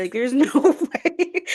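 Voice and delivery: drawn-out, laughing disbelief. Retold or imitated. Reader telling friend she couldn't believe the book was YA, laughing disbelief. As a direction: say it slowly through a laugh, incredulous.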